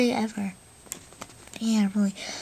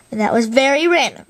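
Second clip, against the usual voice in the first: ordinary delivery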